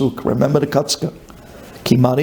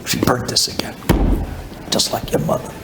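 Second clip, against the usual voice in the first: nearly voiceless retold grumble; muttered complaint; 3 dB quieter than usual. Husband complains about his wife's cooking, muttering. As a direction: grumble it under your breath. Same complaint repeated under breath.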